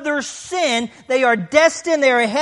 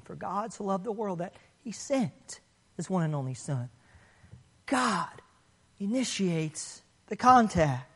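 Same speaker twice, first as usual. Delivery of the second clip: hushed preaching; 7 semitones lower, 10 dB quieter than usual. Acted. Preacher hushing the congregation to stress God's grace, softly. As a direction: lean in and say it quietly.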